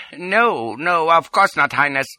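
high, flustered little doctor; anxious placating. A narrator's reading. Doctor placates the supposed prince, anxious and high. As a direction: hurry to agree, pitched high.

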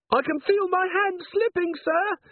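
very high, strained little voice; frightened dwarf corporal. A narrator's reading. Littlebottom dangles from the candle line, squeaking anxiously. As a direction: squeak it out while straining to hold on.

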